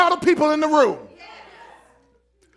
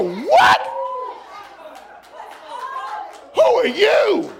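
startled; 9 semitones higher, much slower than usual. Acted. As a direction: caught off guard. Jesus startled by the woman.

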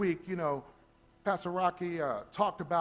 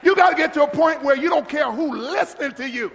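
fiery preaching shout; 6 semitones higher, 11 dB louder than usual. Real, not acted. Preaching at full force.

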